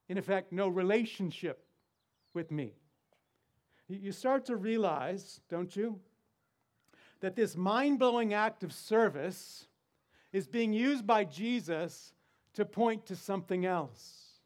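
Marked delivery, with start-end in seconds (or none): none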